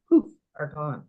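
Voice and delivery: ordinary talk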